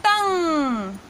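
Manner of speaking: तंग said clearly with the nasal ng; teaching demo. Shown as a demonstration. Teacher showing students how to say तंग. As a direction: model तंग for learners. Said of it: "तो उच्चारण होगा".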